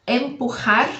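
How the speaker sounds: Empurrar with a throaty Spanish j, empujar; Spanish jota sound. Shown as a demonstration. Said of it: "presta muita atenção a esse som".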